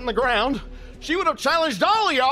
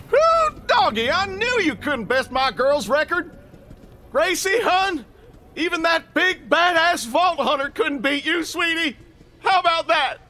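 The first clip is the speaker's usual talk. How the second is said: loud and high, cheering in triumph; triumphant cheer; 5 semitones higher, 5 dB louder than usual. Acted. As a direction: shout it out to his dead daughter in victory. Celebrates record kept for daughter.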